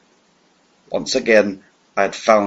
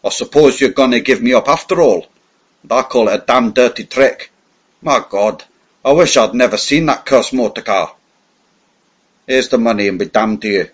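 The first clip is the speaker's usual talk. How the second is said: louder, sulky and cursing; surly tramp; 3 semitones higher, 5 dB louder than usual. Acted. Cornered spy playing a tramp, sulking and cursing at his captor. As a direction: grumble it like a bitter vagrant. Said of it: "I put on my surliest face".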